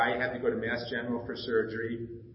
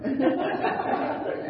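a much higher, airless burst of laughter; laughing; 11 semitones higher than usual. Real, not acted. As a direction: laugh at yourself, high and breathless. Laughing at his poor memory.

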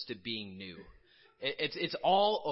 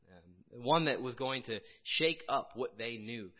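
normal talking voice

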